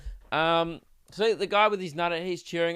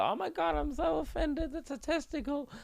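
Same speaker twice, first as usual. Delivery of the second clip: mock offended girl, much higher and softer; 11 semitones higher, 6 dB quieter than usual. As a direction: squeal it like a scandalised girl clutching her pearls. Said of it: "one of them might start crying".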